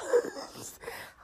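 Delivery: a high, breathy laugh breaking into tears; tearful laugh-cry. Real, not acted. Telling listeners about the song, laughing while crying. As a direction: laugh through sudden tears. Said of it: "I'm crying".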